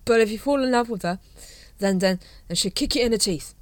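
put-on slurred, loud, rambling drunk captain; drunk Vimes. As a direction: play a tipsy officer waxing on.

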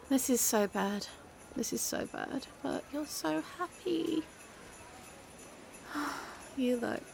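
very soft, hushed lament; small, quiet voice of regret; defeated despair. Acted. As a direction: murmur it, giving up. Despairing at happy friend.

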